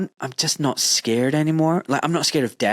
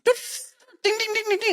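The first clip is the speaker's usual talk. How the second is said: imitated scoreboard ding. Scoreboard sound after death.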